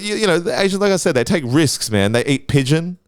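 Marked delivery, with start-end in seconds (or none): none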